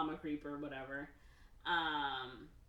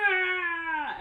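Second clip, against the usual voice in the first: a loud, high wail of grief; loud high wail of grief from everyone; anguished cry; 12 semitones higher, 11 dB louder than usual. Retold or imitated. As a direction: let out a sudden pained cry. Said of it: "everyone's like".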